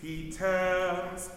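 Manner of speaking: slow spoken lyric. Shown as a demonstration. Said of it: "Sing this on text now".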